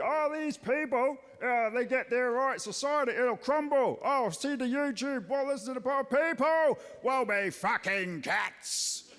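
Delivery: mild hammed-up moral panic; escalated Steve. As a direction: push the panicky pundit a notch further. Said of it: "similar but escalated point of view".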